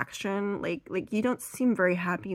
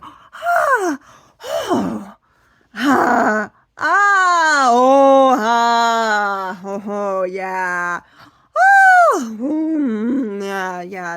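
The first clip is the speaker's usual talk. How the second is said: loud, high drawn-out moans and gasps; over-the-top mock moans of ecstasy; faked orgasm; 4 semitones higher, 11 dB louder, much slower than usual. Overreacting to being handed a gift, hammed-up ecstatic moaning. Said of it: "pretend that I am having a happy orgasm".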